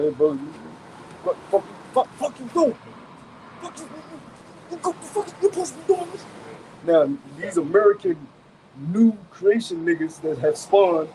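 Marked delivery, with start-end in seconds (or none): 0.0-6.2 imitating a man calling out a brother, pitch climbing high; hammed-up "what are you doing" challenge, stammering; mock confrontation. Acting out men correcting each other, high mock outrage. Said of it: "The men among the men would be like".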